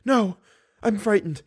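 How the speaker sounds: put-on high-pitched frightened young woman; anguished. A narrator's reading. Girl begs monster not to darken room, anguished. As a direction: plead fearfully in a high voice. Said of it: "Christine's voice of anguish".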